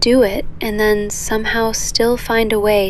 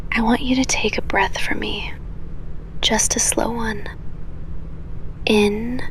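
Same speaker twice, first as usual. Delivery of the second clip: soft guided breathing; 5 dB quieter than usual. Acted. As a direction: breathe them down gently in a whisper.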